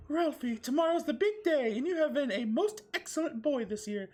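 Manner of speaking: high, loud falsetto mom, laughing through it; mother's comforting voice. Acted. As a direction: coo like a doting mother, breaking into laughs. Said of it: "said Ralphie's mother with comfort words".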